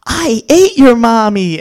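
loud, leering mock threat; mock menacing. Coach close in a toddler's face, loud mock menace. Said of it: "I get real close in his face".

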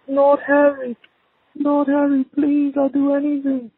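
very high desperate mother begging; pleading mother. A narrator's reading. Mother begs for Harry's life, very high pleading. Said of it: "his mother's voice".

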